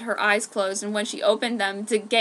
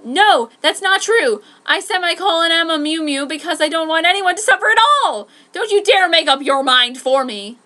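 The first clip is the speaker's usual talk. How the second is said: yelling; 7 semitones higher, 10 dB louder than usual. A narrator's reading. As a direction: scream it right back at him.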